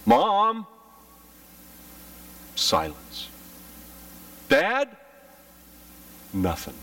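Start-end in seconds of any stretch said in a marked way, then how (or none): 0.0-0.7 put-on child calling. Child calling out in empty house. 4.5-5.0 put-on child calling.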